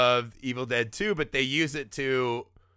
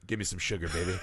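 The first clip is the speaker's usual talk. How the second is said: lower, soft cheesy growl; smug Ash line; 8 semitones lower, 7 dB quieter, much faster than usual. Retold or imitated. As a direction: drop the voice, full cheese. Same line, low and far.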